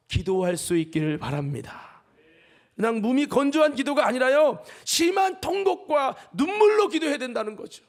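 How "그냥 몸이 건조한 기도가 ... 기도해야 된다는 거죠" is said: high, loud exhortation; fervent preaching. Acted. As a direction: urge them to pray with tears. Preaching at full force.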